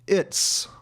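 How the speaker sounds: "It's" ending in a hissed S. Shown as a demonstration. Teacher demonstrating the contraction, "It's" with an S sound. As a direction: say "It's" with an unvoiced s at the end. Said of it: "pronounce the ending like an S".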